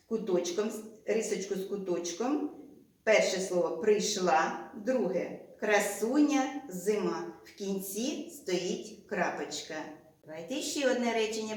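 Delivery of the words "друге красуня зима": ordinary talk, nothing marked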